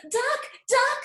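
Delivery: high, loud urgent call. A narrator's reading. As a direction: call the duck across the pond. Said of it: "said Hen".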